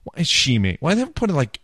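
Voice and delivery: bewildered; baffled. Real, not acted.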